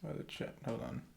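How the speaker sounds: oh, the chat, under the breath; whispered realization to yourself; hushed oh as it dawns; breathy whisper. Real, not acted.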